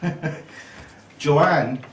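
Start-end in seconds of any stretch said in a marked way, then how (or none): 0.1-0.8 brief chuckle under the breath; a quick, soft laugh; short laugh. Real, not acted. Speaker laughs after being told off.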